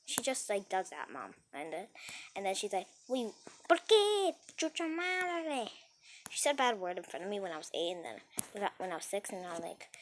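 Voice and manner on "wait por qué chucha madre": high, drawn-out mock Spanish exclamation; imitated outburst. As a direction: act out mom's loud disbelief, stretching the words. Acts out mother's startled outburst in Spanish.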